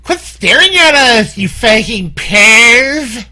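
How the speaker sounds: put-on high offended Valley Girl snap; Valley Girl telling off a perv. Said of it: "do my Valley Girl voice".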